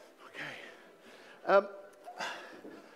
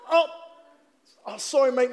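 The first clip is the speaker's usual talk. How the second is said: usual speaking voice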